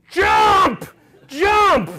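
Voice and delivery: full-force high scream; imitated frantic bellowed command. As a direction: scream the order desperately. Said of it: "he's screaming".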